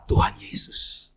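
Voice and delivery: slow breathless whisper of relief; whispered exclamation. Retold or imitated. Wife on the phone, whispering in relief. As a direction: breathe the words out slowly. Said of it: "Tiba-tiba ada suara".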